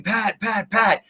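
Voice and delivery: high-pitched mock call; retold yelling. Coaxing John's wife to get up there, a mild retold yell. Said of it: "And I was yelling".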